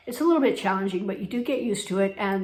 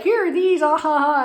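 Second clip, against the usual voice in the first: high, loud mock taunt with laughing syllables; teasing; 8 semitones higher, 8 dB louder than usual. Shop staff bringing out high pumps to her, mock teasing. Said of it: "They were teasing me".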